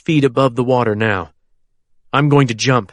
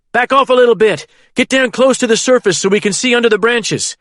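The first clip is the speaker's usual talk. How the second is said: high loud commands; shouting; 10 semitones higher, 3 dB louder than usual. A narrator's reading. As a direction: bark the orders. Shouting orders over helicopter.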